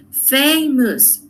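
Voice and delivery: "Famous" in two drawn-out syllables for counting. Shown as a demonstration. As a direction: sound out "Famous" one syllable at a time. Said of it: "dos sílabas".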